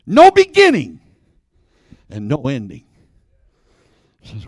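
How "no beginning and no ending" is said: imitated teacher explaining. Teacher defining eternal for a boy, imitated with dramatic emphasis. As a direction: swell loud on the first half, then sink. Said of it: "She said".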